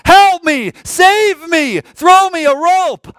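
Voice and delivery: desperate scream, high and loud; high-pitched, urgent cry for rescue; screaming for help. Acted. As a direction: shout it like someone drowning. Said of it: "they're screaming".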